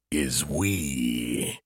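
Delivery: low, slow machine voice; cold mechanical AI voice; robot voice. A narrator's reading. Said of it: "came a robotic voice".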